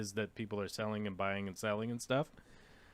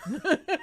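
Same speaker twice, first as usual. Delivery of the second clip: high, loud short laugh; sudden high chuckle; quick high laugh; 5 dB louder than usual. Real, not acted. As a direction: burst out laughing at the cohost. Laughs as cohost stands up.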